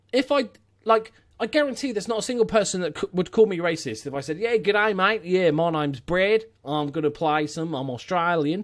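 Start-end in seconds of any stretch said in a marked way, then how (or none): 4.4-8.6 broad put-on Australian accent; hammed-up Australian impression. Shown as a demonstration. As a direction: do a matey Aussie accent. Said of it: "in case you didn't know the accent".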